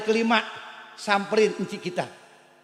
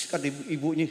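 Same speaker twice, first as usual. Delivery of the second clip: normal talking voice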